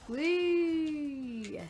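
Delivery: ordinary delivery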